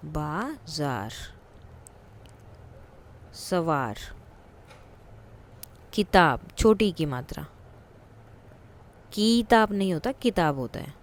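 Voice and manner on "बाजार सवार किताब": slow dictation. Shown as a demonstration.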